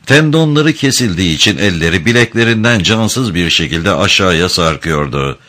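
ordinary delivery, nothing marked

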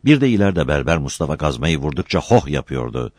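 plain reading voice

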